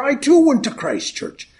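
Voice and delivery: put-on high, loud old Irish gent's voice; old Irishman. Old gent quizzing his drinking partner, in a high Irish old-man voice. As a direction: pipe up like a chatty old codger.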